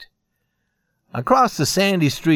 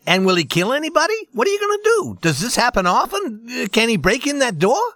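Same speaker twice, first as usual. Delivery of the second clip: high, fast, rising panic; panicky questions; 9 semitones higher than usual. A narrator's reading. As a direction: pile up the questions in fear. Salesman panics at the news.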